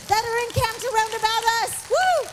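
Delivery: high, excited preaching with shouts of praise; preaching at full force. Real, not acted. Preacher rousing the church to praise, fervent. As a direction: lift the congregation with praise.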